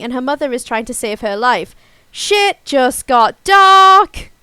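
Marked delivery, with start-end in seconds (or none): 2.1-4.3 dramatic exclamation. Real, not acted. Reacting to dark plot twist.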